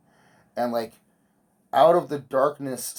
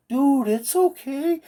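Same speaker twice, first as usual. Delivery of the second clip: much higher, slow coaxing voice; slow soothing; 13 semitones higher, 4 dB louder, slower than usual. Retold or imitated. Calming the cock dragon in the dark, slow high coaxing. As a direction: coax it like a spooked pet. Soothing hallucinated creature again.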